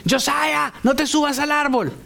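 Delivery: put-on high-pitched parental call to a child; acted warning shout. Preacher calls to Josiah up a tree, acted warning shout. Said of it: "Grito no quiere decir".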